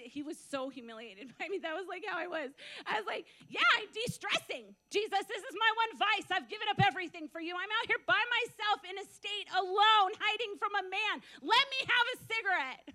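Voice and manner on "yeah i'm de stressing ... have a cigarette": higher-pitched, insistent bargaining; retold indignant plea. New believer arguing with Jesus for one vice, mock indignant. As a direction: plead your case, hammed-up and exasperated.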